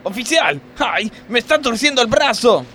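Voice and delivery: high, loud pained yelping; pained cries. Acted. Worker cries to the officer twisting his arm. Being arrested by police.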